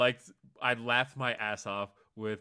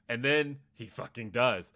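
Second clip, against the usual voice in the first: louder, punching the slap moment; excited emphasis; 8 dB louder than usual. Real, not acted. Fan telling cohost the big slap, excited. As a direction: hype it up as it lands.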